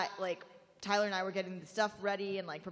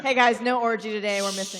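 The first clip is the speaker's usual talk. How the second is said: loud mock announcement; 13 dB louder than usual.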